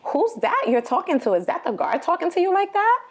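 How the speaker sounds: alarmed questioning. Retold or imitated. Mother retelling her questions to son on prison phone, high-pitched alarm. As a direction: reenact a worried, high-pitched demand to know.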